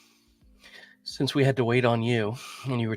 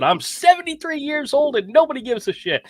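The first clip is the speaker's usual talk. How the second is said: louder, higher punchline quote; mock-sassy retort; 12 semitones higher, 4 dB louder than usual. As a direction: deliver the line to the scammer with cheeky attitude. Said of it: "And I said".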